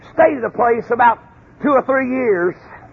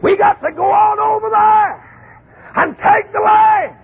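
slow, higher, building to a shout; rallying cry; 9 semitones higher, slower than usual. Retold or imitated. Caleb urging the spies to take the land, building to a shout. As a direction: urge the men forward with rising force.

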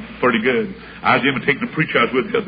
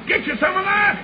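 high, laughing offer; high delighted offer; gleeful. Retold or imitated. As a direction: pitch it way up with delight.

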